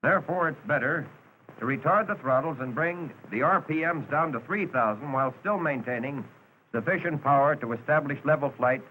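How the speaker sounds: ordinary talk, nothing marked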